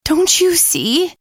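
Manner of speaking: high, slow, frantic pleading; hysterical. Acted. Nikki panicking at her friends, hysterical and high. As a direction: make them see the danger, panicking. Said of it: "She was getting hysterical again".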